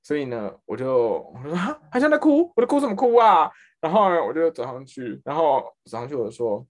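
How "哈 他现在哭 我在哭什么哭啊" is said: high, loud scoff; mock her crying dismissively; scoffing disbelief. Supervisor scoffs that the girl is crying, high and loud.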